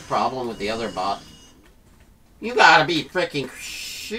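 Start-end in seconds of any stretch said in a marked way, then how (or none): 2.4-4.2 frustrated outburst. Real, not acted.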